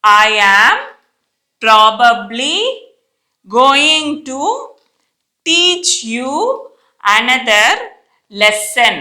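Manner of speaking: separated phrases with room to repeat; careful teacher dictation with long gaps; read-along reading. Shown as a demonstration. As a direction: lead the class through it phrase by phrase. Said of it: "నాతో పాటు చదవండి".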